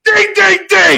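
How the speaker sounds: high excited yell; high shouted ding ding chant; cheering shout. Retold or imitated. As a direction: whoop it up at the goal. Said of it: "I'm going".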